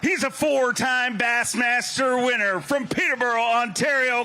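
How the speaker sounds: announcer shout. Acted. Announcer calling the leader up for the crowd, shouting. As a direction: bellow the name like a boxing intro.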